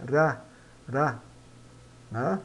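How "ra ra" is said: "ra" with a single tongue tap as in "cara"; teacher modelling "ra"; tapped R of cara. Shown as a demonstration. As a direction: tap the R once, "ra". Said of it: "mas o R de cara".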